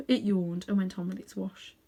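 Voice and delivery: plain reading voice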